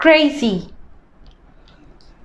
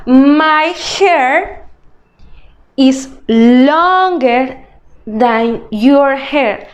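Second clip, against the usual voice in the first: slow, careful model sentence; slow teaching example; much slower than usual. Shown as a demonstration. Teacher modelling a sentence for students, slow and clear. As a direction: spell it out slowly for learners. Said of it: "I'm going to use longer in a sentence".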